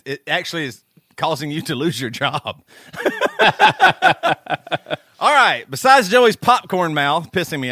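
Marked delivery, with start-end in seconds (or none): 2.9-5.0 bursting into laughter; a loud high cackle. Real, not acted. Laughing at own joke.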